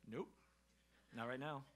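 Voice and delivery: low-key, quiet, awkwardly withholding; underplay the refusal after a pause; deadpan retold reply. Lets it stay awkward with son.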